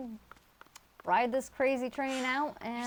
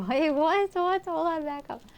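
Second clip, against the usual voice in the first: sudden high, loud disbelief; startled double take; real surprise; 8 semitones higher, 6 dB louder than usual. Real, not acted. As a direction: jolt up in shock. Reacting to chat message.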